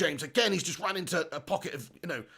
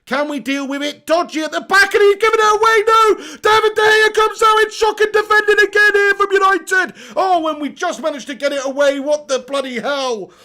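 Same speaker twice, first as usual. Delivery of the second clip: much higher, much louder panicked yell; frantic shout; 10 semitones higher, 11 dB louder than usual. Real, not acted. Fan screaming at his team's defending, panicked shout. Live goalmouth scramble, commentating fan.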